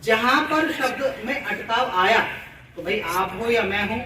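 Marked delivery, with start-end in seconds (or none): none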